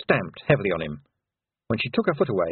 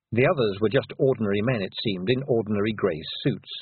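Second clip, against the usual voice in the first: ordinary delivery, nothing marked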